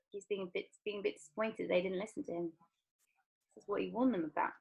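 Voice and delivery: soft explaining aside; quiet aside. Real, not acted. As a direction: murmur the explanation.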